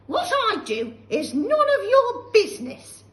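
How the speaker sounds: put-on shrill, loud scolding old woman; cranky old grandma. Grandma scolding George, loud and high. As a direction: snap at the boy like a nasty old hag. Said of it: "the old woman said".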